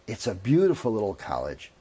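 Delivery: ordinary talk, nothing marked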